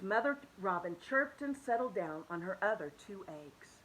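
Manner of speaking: ordinary delivery, nothing marked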